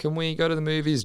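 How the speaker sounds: put-on high, eager kid voice; little boy voice. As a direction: ask like a small boy. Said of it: "from the boy's perspective".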